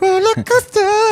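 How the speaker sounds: high-pitched. Pretend. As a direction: stretch it out way up high. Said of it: "I could hit those high notes".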